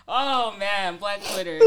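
usual speaking voice

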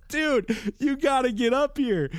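panicked phone call; hammed-up urgency; urgent call. Calling maintenance.

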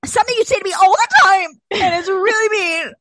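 high, loud mock-aggrieved complaint; loud protest. Real, not acted. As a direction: exclaim it loudly at a friend. Loud teasing complaint to friend.

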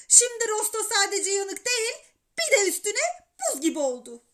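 high, loud lament; exasperated mother. A narrator's reading. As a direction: complain bitterly about the ruined dinner. Anne yanan yemeğe sinirleniyor. (sinirli, tiz sesle sitem ederek)

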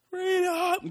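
high shouted freedom cry; Braveheart battle cry. Retold or imitated. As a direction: yell it like a warrior charging.

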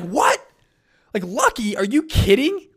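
high, loud incredulous outburst; freaked-out disbelief. Retold or imitated. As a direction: blurt it out in shocked disbelief. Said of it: "he kinda freaked out".

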